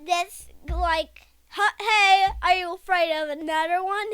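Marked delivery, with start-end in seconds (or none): 1.5-4.1 louder, higher challenge to a rival; acted challenge. Boy voicing a character challenging another, challenging. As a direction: act out confronting someone, loudly. Child acts out confrontation in story.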